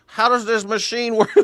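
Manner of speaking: put-on high-pitched, befuddled old man; hammed-up elderly man voice; ancient Uber driver. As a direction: play a very old driver lost and confused. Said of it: "guy driving is eighty-five years old".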